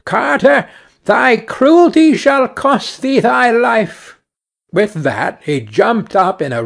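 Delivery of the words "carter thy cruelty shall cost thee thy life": high, slow, otherworldly bird voice; taunting sparrow. A narrator's reading. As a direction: drawl the threat like a vengeful little bird. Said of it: "and cried".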